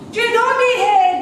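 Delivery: very high, loud Coatbridge screech; Coatbridge accent. Retold or imitated. Caller quizzing the receptionist, loud high Coatbridge accent. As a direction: imitate a Coatbridge caller at full pitch. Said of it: "in her best Coatbridge accent".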